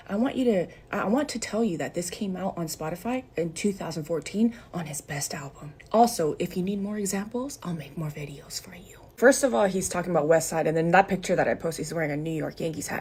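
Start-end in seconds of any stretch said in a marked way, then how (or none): 4.7-5.7 whisper. Real, not acted. Hushed aside to the viewers. 7.6-9.0 whisper. Real, not acted. Hushed threat-like aside.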